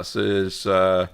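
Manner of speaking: ordinary delivery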